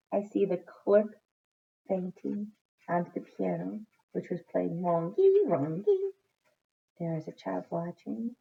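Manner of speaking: ordinary delivery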